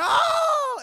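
hammed-up scream of pain; mock scream. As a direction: yelp like cut by a stone. Prophets cutting themselves.

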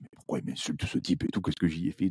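hushed, baffled aside under his breath; puzzled whisper to himself. Retold or imitated. Youtuber recalling an insult, whispering his puzzled thought. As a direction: whisper it as if wondering alone why he's insulted.

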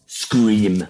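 loud, imitated one-word order; commanding stage speaker. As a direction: order it into a big hall. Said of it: "så säger han bara". (härmat befallande)

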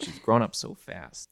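ordinary talk

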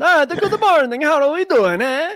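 cartoonish high put-on voice; squeaky comic character voice; goofy high voice.

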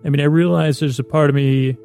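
normal talking voice

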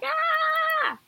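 high mock squeal; sudden high fangirl squeal. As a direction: squeal like a starstruck fan. Mimicking a raving fangirl.

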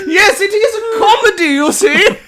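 very high, loud affected laugh; snooty mock laugh. Stuffy theatre crowd at a serious line, braying mock laugh. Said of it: "they'll go".

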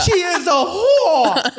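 high, slow yell breaking into a laugh; shouted insult collapsing into laughter; retold yelling. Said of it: "and just yelling".

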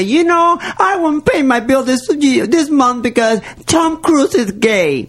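mock phone call. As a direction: play a caller making an absurd excuse. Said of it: "call the electric company and say".

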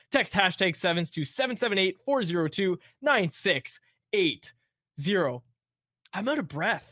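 rushed, breathless in one breath; all in one breath. Shown as a demonstration. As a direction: race through it without a breath. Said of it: "my chest hurts after that one".

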